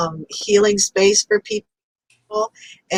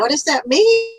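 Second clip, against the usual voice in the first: raised, baffled question; puzzled; 8 semitones higher than usual. Retold or imitated. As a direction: ask it like you truly can't follow. Puzzled reply to guide.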